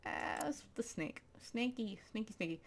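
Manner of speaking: soft whispered creeping. Acted. Whispering about the snake in the cave. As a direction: whisper as if sneaking up.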